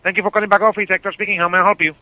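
usual speaking voice

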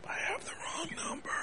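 breathy whispered aside. Real, not acted. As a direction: whisper it so the line can't hear. Aside, realizing mistake.

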